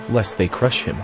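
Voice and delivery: normal talking voice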